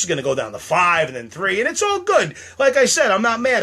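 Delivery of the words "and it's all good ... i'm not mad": much higher, louder strained brightness; forced cheer. Real, not acted. Upset at leaving viewers, forced high cheer. Upset, forcing it's all good.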